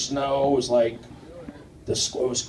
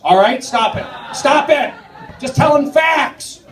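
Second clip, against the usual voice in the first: mock protest; 9 semitones higher than usual. Crowd reacts, comic protests loudly.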